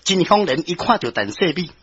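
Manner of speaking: usual speaking voice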